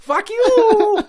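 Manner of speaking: high, loud furious shout; angry yell. Acted. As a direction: scream it back at him. Luigi retorts.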